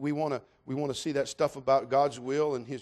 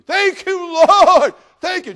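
high, loud, drawn-out shout of gratitude; hollering thanks; 14 semitones higher, 17 dB louder, much slower than usual. Acted. Man on the branch thanking God, hollering. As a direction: shout your thanks up to the sky. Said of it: "he's hollering out".

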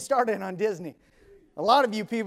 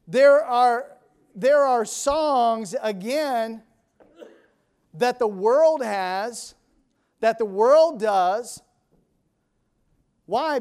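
forceful preaching; 4 semitones higher, 7 dB louder, slower than usual. Acted. Preaching about worldly songs.

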